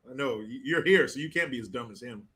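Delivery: ordinary talk, nothing marked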